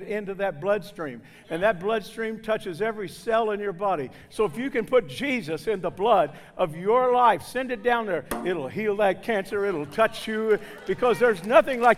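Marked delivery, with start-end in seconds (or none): none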